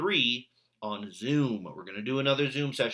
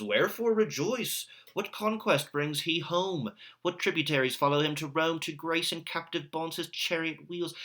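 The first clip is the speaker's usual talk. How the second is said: Marullus scolding the crowd, higher and declaiming; indignant tribune; 7 semitones higher than usual. Acted. Teacher reads Marullus scolding the commoners, declaiming indignantly. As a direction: read it as a stern Roman rebuking the crowd.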